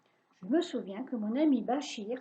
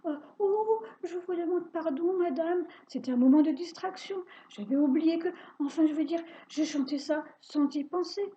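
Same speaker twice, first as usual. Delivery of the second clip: higher, nervous excuses; flustered apology; 5 semitones higher, 3 dB louder than usual. Acted. Boy making excuses to the witch, higher and flustered. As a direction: babble an apology, rattled. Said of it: "j'essaie de m'excuser".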